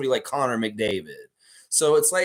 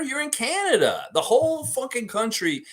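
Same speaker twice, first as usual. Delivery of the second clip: ranting; 13 semitones higher than usual. Real, not acted. Ranting at West Coast haters.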